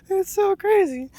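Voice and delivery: high, loud laugh into the words. Real, not acted. Telling viewers about the stranger's [name] fandom, laughing.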